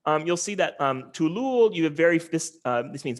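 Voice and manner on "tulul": "tulul" with no pitch movement; very flat tone. Shown as a demonstration. Linguist showing the word for banana, flat pitch. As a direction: keep both syllables of "tulul" level. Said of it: "is very flat".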